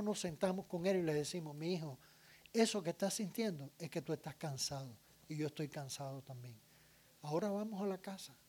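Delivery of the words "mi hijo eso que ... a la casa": put-on soft, low, calming words to a tired child; gentle parent. Parent sitting with the tired child at market, gentle and soft. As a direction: soothe him quietly and unhurried.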